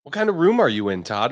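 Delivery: usual speaking voice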